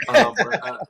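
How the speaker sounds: loud high laugh; sudden loud laugh, pitch shooting up; burst of laughter. Real, not acted. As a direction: break into a real laugh. Laughs at nasty school food.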